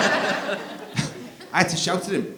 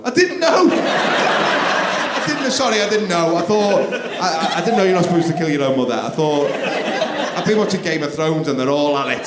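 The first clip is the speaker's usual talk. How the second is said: mocking laugh; 6 dB louder than usual. Dad mocking son's excuse, loud laughing. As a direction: ridicule the excuse while laughing. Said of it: "mocking son's excuse".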